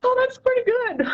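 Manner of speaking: high, delighted surprise breaking into a laugh; pleasantly surprised. Retold or imitated. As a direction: squeal it like finding your old poem is good. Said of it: "and I'm like".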